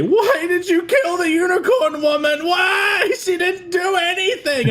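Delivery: high, loud whiny protest; hammed-up fan complaining about the unicorn death; mock outraged fan. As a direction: imitate an upset commenter. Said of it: "comments on Newgrounds that say".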